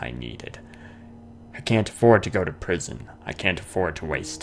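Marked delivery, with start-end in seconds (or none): none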